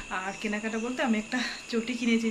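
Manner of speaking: normal talking voice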